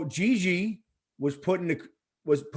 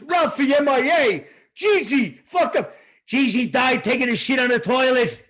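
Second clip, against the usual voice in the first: loud mobster impression, hammering each phrase; Paulie Walnuts; 7 semitones higher, 6 dB louder than usual. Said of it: "Paulie goes".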